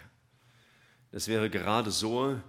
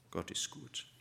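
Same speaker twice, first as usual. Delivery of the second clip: hushed echo; 3 semitones lower, 10 dB quieter than usual. Real, not acted. Quiet repeat after shout.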